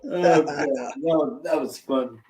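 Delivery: ordinary talk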